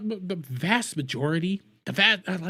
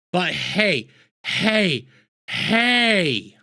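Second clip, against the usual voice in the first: each hey louder than the last; calling out; 4 dB louder, much slower than usual. Real, not acted. Yelling back at the governor's clip, building hey. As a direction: call him out, building.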